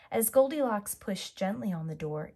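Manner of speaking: plain reading voice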